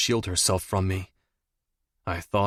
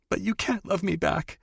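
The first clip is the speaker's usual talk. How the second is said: much higher, tearful; tearful lover; 10 semitones higher than usual. A narrator's reading. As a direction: protest through tears. Audrey tearful.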